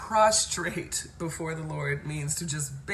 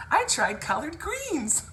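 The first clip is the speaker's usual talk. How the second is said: high, drawn-out mimicry of a friend; mock her slowly, pitched way up; imitating girlfriend; 10 semitones higher, 3 dB louder than usual. Friend bragging about trying collard greens, high-pitched imitation. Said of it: "she would say".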